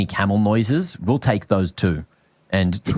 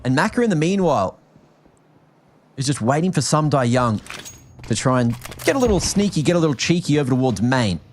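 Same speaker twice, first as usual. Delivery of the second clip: esports play-by-play; 3 semitones higher than usual. Acted. Continues casting.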